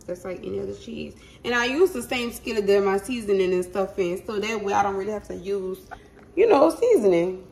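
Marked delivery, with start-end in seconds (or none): none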